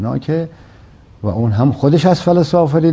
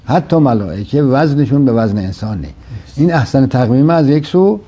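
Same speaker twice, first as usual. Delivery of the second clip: normal talking voice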